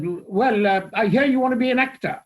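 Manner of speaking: booming actor-manager greeting; Geoffrey Kendall. Retold or imitated. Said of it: "And he said".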